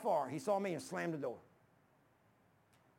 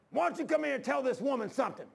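higher-pitched, worked-up demand; exasperated holler; 6 semitones higher, 3 dB louder than usual. Retold or imitated. Man arguing with his wife calls the preacher in, exasperated holler. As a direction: imitate a fed-up husband calling out.

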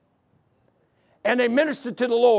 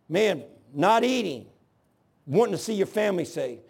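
ordinary talk, nothing marked

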